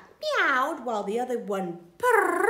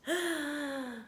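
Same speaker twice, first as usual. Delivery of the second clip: gasp; 5 semitones higher, 5 dB quieter than usual. Acted. Reacting to the thieves' plan.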